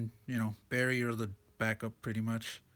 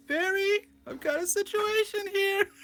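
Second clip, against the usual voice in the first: put-on much higher, slow, squeaky girl voice; squeaky little Jill voice; 5 dB louder, slower than usual. Comic reader imitating Jill calling Barry, squeaky high voice. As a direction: squeak it out like an old game heroine. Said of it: "squeaky little voice from Resident Evil one".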